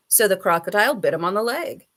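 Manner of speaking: usual speaking voice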